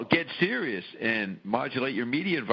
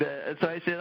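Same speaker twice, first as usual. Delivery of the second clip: ordinary talk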